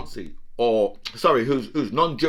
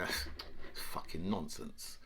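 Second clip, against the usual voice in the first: slow, softer, haughty posh voice; mock posh dismissal; 5 semitones lower, 6 dB quieter, slower than usual. Comic mocking British and Americans dismissing Putin, posh. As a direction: brush it off like a snooty diplomat. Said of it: "the British and the Americans say".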